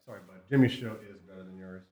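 ordinary delivery

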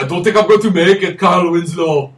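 high, loud dying hero plea; wounded soldier gasping. Retold or imitated. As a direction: strain it out like a dying man. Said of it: "we're like".

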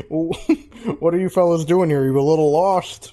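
lightly voiced ogre king, puzzled. Ogre king questions the disguised party, lightly voiced.

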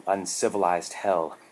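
plain reading voice

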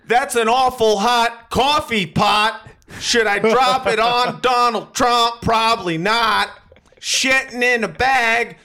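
slow, high, stretched-out mock performance; hammed-up rap-poem with every phrase drawn out; slam poetry parody. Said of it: "poetry slam and just be like".

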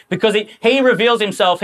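ordinary delivery

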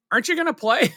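high, sweet imitated question; coaxing store owner. Owner asking the shy customer, high coaxing voice. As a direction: lift it up high like a kindly shop owner.

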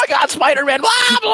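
high, far-off shrieking freak-out; Imran overreacting. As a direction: shriek it like a frantic fanboy. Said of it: "how you imitate an Imran speech".

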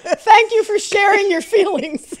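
high, loud and laughing, slowed way down; laughing sarcastic gratitude; laughing mock thanks. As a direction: gush your thanks while laughing. Mocking the boss's apology.

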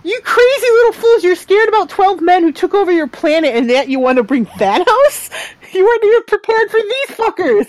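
high mock rant at the foolish aliens; mock scolding. Berating the characters mockingly.

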